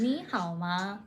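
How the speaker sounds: ordinary talk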